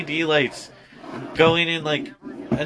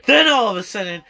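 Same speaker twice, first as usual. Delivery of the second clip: normal talking voice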